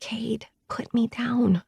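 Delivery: slow, hushed whisper. Acted. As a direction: breathe it so nobody hears. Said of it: "as I whisper".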